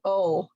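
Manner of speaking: oh, a vowel before the L; oh said with a vowel onset; L with added vowel. Shown as a demonstration. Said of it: "you will not get".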